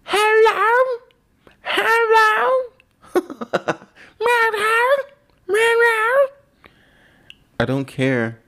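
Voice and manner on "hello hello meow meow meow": very high, squeaky meowing hello; cat voice saying hello. Retold or imitated. Imitating the cat behind the door, very high and squeaky. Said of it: "and he goes".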